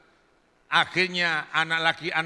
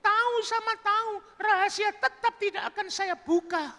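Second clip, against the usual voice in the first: very high falsetto coaxing; coaxing woman. Acted. Woman coaxing Burhan to remember her, high falsetto. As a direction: coax him in high falsetto.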